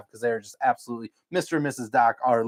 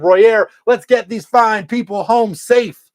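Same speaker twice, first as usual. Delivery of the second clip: loud, slow, higher host call; announcer voice; 8 semitones higher, 10 dB louder, slower than usual. Acted. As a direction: boom out the sign-off. Sign-off announcement to cohost.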